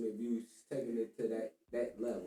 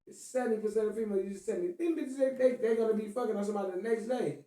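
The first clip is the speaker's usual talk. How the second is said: much higher, louder ranting; heated rant; 8 semitones higher, 4 dB louder than usual. Real, not acted. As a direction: push it up in disbelief. Heated rant about women.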